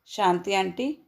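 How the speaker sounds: ordinary delivery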